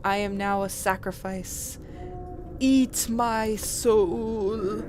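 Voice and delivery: very slow, loud drawn-out plea; mock sacrifice. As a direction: offer yourself up theatrically.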